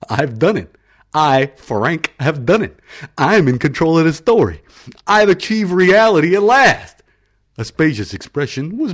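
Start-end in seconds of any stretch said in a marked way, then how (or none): 0.1-7.0 rising, louder and louder gloat; triumphant crow. Acted. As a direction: crow over your victory, building higher. Frank triumphant over taking control.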